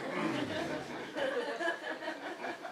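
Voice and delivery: high giggle at his own joke; high laughing burst. Real, not acted. Comic laughing at his own story, high giggle.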